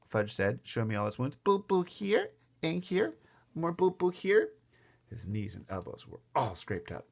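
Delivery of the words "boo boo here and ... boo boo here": put-on high, slow toddler voice; hurt small boy. A narrator's reading. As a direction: show each hurt in baby talk. Small child shows wounds.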